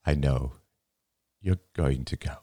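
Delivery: understated, halting sadness; tearful. A narrator's reading. Father accepting his daughter will go, mildly tearful. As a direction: hold back tears as you speak. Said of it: "said Bert, tears filling his eyes".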